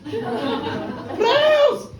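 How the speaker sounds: high, incredulous question, half laughing; mother can't believe the doctor; shocked disbelief. Acted. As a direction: squeak it out in disbelief. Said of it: "mother's shocked reply to doctor".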